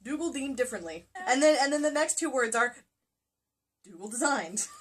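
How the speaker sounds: ordinary talk, nothing marked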